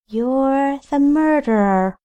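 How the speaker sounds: high, ghostly accusing voice; eerie high pitch. A narrator's reading. Ghost Rachel accuses Paxton in an eerie high voice. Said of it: "risen to an eerie pitch".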